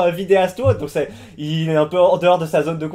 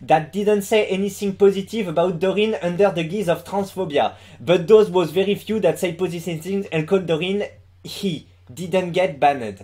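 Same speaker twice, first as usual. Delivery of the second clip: English read with a thick French accent; basic French accent. Shown as a demonstration. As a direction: sound like a French speaker reading English. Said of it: "je tente l'accent français de base". (accent français de base en anglais)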